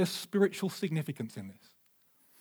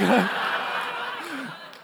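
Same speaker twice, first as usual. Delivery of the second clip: joyful laugh; 9 semitones higher than usual. Retold or imitated. Overjoyed father's laugh.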